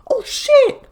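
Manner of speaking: very high, loud mock yelp; panicked shock. Byzantines see ships behind the chain, panicked yelp. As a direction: squeal it in hammed-up alarm.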